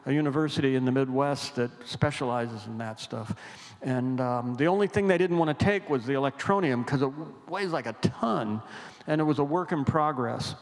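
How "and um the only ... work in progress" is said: normal talking voice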